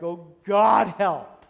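slow, loud, exasperated groan of a prayer; mock despairing parent. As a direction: drag it out like a worn-out parent begging heaven. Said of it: "You're like".

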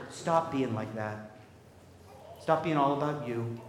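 a tender father's quiet plea; gentle pleading father. Acted. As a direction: coax him like a patient father. Father pleads with the older son.

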